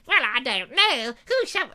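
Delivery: very high, loud plummy lady; high posh bride. Pretend. Bride answering the prince in a high plummy voice. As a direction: trill it like a prim royal lady.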